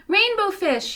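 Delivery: high calling voice of a small fish. Acted. Little blue fish calling after the rainbow fish, high call. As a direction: call out to him from behind. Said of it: "he called".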